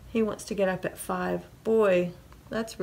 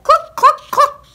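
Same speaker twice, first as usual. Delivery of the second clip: very high, loud clucks; chicken clucks; 14 dB louder than usual. A narrator's reading. Chicken getting the farmer up, clucking loudly. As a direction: cluck sharply like an agitated hen. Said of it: "the chicken said".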